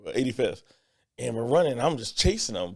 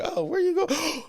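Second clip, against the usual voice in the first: high calling voice; mock mom, high and sing-song. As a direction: call after the kid like a worried mom. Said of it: "But the mom's like".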